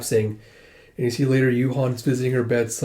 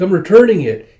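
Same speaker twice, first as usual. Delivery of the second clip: put-on high, loud little-boy voice; young child; 7 semitones higher, 10 dB louder than usual. Eight-year-old Suwon returns a book to Minsu, young child voice. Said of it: "this is eight-year-old Suwon".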